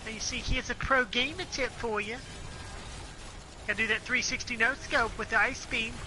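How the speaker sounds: high, mock-hyped pro gamer. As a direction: brag like a cocky streamer. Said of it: "stop talking like that".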